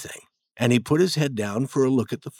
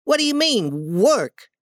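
higher, feigned surprise; innocent boy Tom; 8 semitones higher, 3 dB louder than usual. Acted. Tom pretends to enjoy painting to hook Ben, higher voice.

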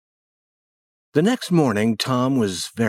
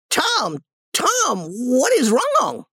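very high, drawn-out alarmed aunt; crying out; much slower than usual. Acted. Aunt rushing to Tom's bedside, high alarmed cry. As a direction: wail the question in fright. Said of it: "she cried".

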